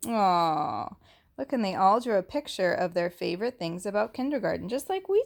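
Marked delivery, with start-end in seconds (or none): none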